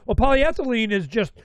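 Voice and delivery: ordinary talk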